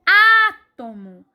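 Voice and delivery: loud, long, high A in átomo; stressed first syllable. Shown as a demonstration. Teacher demonstrating átomo's strong syllable, opening A stressed. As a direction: hit the "á" of átomo hard and stretch it. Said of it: "A sílaba mais forte é o A".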